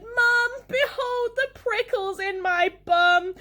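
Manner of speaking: loud, high-pitched wail to Mum; shouting. A narrator's reading. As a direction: cry out in theatrical agony. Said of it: "I shouted".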